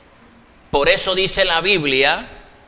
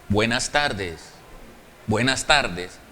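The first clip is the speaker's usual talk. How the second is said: much softer, lower, polite "Buenas tardes"; calm greeting; 8 semitones lower, 6 dB quieter than usual. Acted. Secretary calmly greeting a rude patient, soft and polite. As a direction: answer the rudeness with quiet courtesy.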